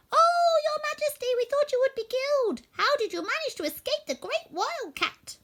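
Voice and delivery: excited mice. A narrator's reading. Mice rushing to their queen, high excited squeaks. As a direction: burst out with relief. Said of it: "they exclaimed".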